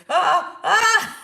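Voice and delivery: loud, high re-enacted labor screams; two sharp cries of sudden pain; retold scream. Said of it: "I heard my own screaming".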